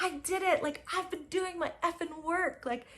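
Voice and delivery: high, elated self-praise; giddy victory; triumphant celebrating. Retold or imitated. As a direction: crow about your win. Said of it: "I was like".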